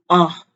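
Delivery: short loud AH dropping sharply; fourth tone. Shown as a demonstration. Teacher showing the fourth tone, AH sharply falling. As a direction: hit AH hard, then let it fall. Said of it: "starts out loud but drops sharply".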